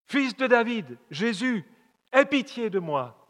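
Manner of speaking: high, loud desperate plea; crying out. Retold or imitated. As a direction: call out to Jesus from the roadside. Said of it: "Et il crie".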